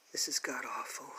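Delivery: hushed, disgusted whisper under the breath; a real whispered groan at the page; whispered aside. Real, not acted. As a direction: whisper it to yourself in dismay. Muttered aside while reading.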